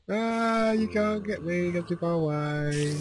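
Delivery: high, drawn-out taunt; winding them up. Pretend. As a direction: tease them from out of reach, high and slow. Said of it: "I'm winding the skeletons up".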